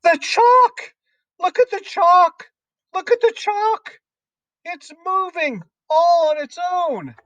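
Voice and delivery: very high, hysterical schoolboy alarm; excited pupil's shrill scream. A narrator's reading. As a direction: shriek in amazement at something impossible. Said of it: "and screaming".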